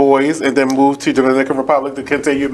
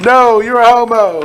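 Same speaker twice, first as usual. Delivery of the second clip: laughing tease; 8 semitones higher, 4 dB louder than usual. Real, not acted. Teasing friend, laughing.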